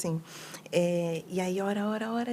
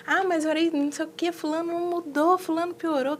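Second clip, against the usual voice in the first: higher-pitched imitated whining about unanswered prayer; mock complaint; 6 semitones higher than usual. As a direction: play the frustrated believer who feels unheard. Imitating a frustrated praying person.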